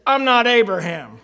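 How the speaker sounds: loud, forceful comeback; defiant retort. Retold or imitated. Man answering the elder in Sunday school, loud and defiant. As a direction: throw it back at him hard.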